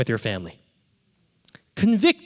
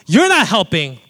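raised, exasperated retort; annoyed wife; 5 semitones higher, 8 dB louder than usual. Retold or imitated. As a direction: imitate a fed-up wife, louder and higher. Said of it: "I hear her getting annoyed".

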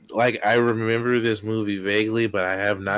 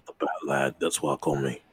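fake British accent; 5 semitones lower than usual. British Batman answers the Riddler's doubt, fake British accent. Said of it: "fake accent that you're doing".

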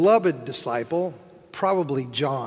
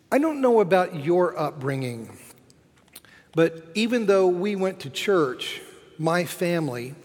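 ordinary talk, nothing marked

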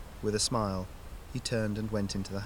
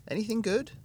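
higher woman's voice, curious; wife voice; 13 semitones higher, 3 dB louder than usual. A narrator's reading. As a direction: voice the wife asking. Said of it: "Hannah asking".

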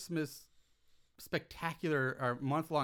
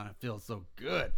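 ordinary talk

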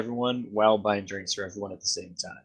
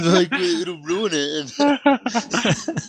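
drawn-out mock patron; 7 semitones higher, 4 dB louder, much slower than usual.

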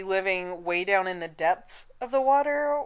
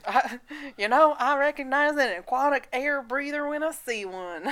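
put-on Southern accent; 5 semitones higher than usual. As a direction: do Bob in a Southern accent, laughing. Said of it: "I don't know why he's Southern".

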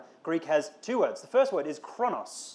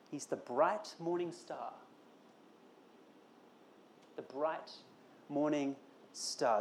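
softer, slower, hushed wonder; reverent; 8 dB quieter, slower than usual. Acted. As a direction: let the name linger in awe. Awed repetition of Jesus' name.

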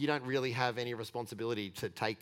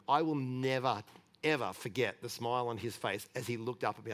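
normal talking voice

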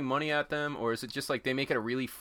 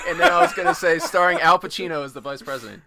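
laughing; 5 semitones higher, 10 dB louder than usual. Real, not acted. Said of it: "Damn it".

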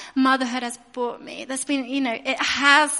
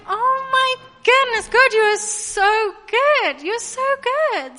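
much higher, slow and drawn-out gushing; gushing awe; 9 semitones higher, 5 dB louder, slower than usual. Retold or imitated. Mum hearing a stranger will adopt, slow high gush.

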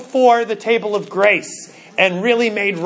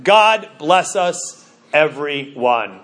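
tender blessing; 7 semitones lower, much slower than usual. Retold or imitated. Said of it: "as Tiny Tim observed".

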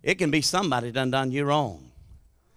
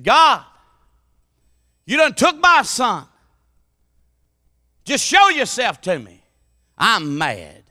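raised, high pleading shout at God; retold angry cry to God; 4 semitones higher, 6 dB louder, slower than usual. Grieving father shouting at God in a dark church. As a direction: cry out to God in grief and anger. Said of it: "I screamed in a dark church".

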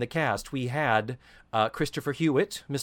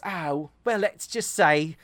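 coy Cockney, pitched up; lilting Cockney punchline; Cockney character; 4 semitones higher, 4 dB louder than usual. Retold or imitated. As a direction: say it knowingly in hammed-up Cockney. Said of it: "And she says".

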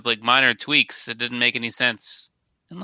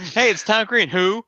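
high, playful call-out; hammed-up high quote; quoted Tom Green bit; 10 semitones higher than usual. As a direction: sing it out high, mock-excited. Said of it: "They go".

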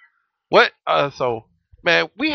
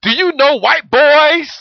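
high, loud and slow quizzing; mock stern parent; 10 semitones higher, 7 dB louder, slower than usual. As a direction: grill the kid in a mock-outraged voice. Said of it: "I said".

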